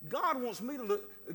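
ordinary talk

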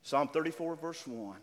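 ordinary delivery

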